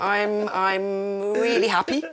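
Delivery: high, drawn-out stalling cheer; mock happy announcement. Daughter dodging telling her mum, mock happy. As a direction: stretch the words out, comically overbright.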